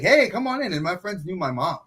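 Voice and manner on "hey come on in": welcoming mom. Retold or imitated. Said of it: "my mom was like".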